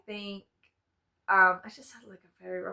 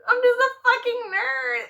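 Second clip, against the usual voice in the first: higher, louder self-mocking exclamation; exclaimed; 11 semitones higher, 6 dB louder than usual. Admitting to viewers she is a nerd, exclaimed loudly. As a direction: own it out loud with a laugh.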